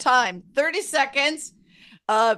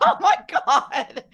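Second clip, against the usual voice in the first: slow words through real laughter; real laugh at the actors; 4 semitones higher, slower than usual. Real, not acted. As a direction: laugh it out helplessly. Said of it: "while I'm laughing".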